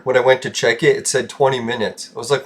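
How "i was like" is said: normal talking voice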